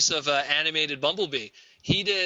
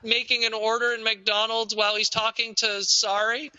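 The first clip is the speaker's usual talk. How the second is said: much higher, overeager fan question; mock eager fan; 5 semitones higher than usual. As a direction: pester him like a starry-eyed fan. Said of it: "Now he's doing panels".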